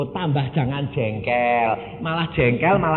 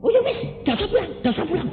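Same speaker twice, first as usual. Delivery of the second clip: high, very fast retreat; hasty backing down; 14 semitones higher, much faster than usual. Retold or imitated. Frightened sister tells him not to come home, high and hurried. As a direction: rush it out, suddenly changing her mind.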